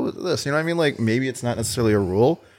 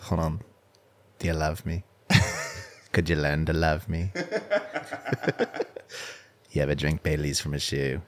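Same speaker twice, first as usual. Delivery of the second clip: put-on very old faint voice, lower and softer; breathy creepy Old Greg whisper; 7 semitones lower, 9 dB quieter than usual. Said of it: "like old Greg when he's whispering".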